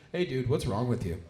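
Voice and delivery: low-pitched, subdued question; radio host. Retold or imitated. Radio host checks on the wrecked comic, low-voiced question. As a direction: imitate a host asking, voice dropped low.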